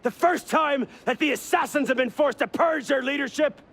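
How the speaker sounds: high-pitched shouted tirade; angry tirade. Acted. Veteran assassin justifies purging the leadership, angry tirade. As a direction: rail at him, question upon question. Accused man rants back.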